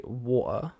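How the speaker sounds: British "water" with the T dropped to a glottal stop; exaggerated British "water", no T. Shown as a demonstration. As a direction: say "water" as wo'uh, in a broad British accent. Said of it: "I don't actually say it in the most British way".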